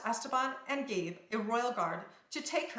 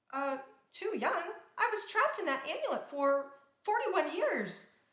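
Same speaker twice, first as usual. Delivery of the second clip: indignant; 8 semitones higher than usual. A narrator's reading. Princess protests being too young.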